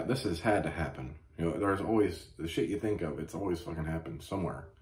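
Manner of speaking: normal talking voice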